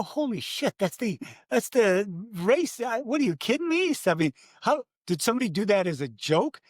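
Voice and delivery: high-pitched shocked disbelief; retold disbelief. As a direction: gasp at the forecast, voice jumping up. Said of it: "I go".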